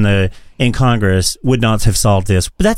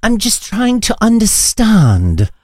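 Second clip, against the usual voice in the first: mock British reporter; 9 semitones higher than usual.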